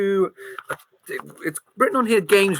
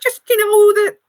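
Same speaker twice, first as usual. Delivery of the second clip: high squeaky mouse voice; a little mouse gossiping; 9 semitones higher than usual. Acted. Mouse telling the player about Santa, high.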